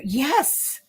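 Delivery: normal talking voice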